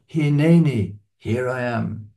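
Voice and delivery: "Hineni" said as hee-NAY-nee, first syllables loud; Hebrew word. Shown as a demonstration. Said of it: "we've heard this before".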